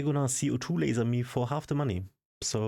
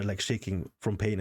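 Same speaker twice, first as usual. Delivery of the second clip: ordinary talk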